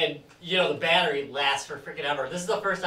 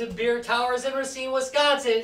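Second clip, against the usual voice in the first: big, higher-pitched announcer intro; show announcer; 8 semitones higher, 4 dB louder, slower than usual. Acted. Host opens the podcast live, big announcer voice. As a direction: belt out the show title, building to a shout.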